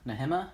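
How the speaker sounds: second guess at "Nehemiah", nuh-HIM-uh, as a soft question; unsure pronunciation. Shown as a demonstration. Teacher stumbles on a Bible name, soft questioning "Nehemiah" as nuh-HIM-uh. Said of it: "that's how we'll say it".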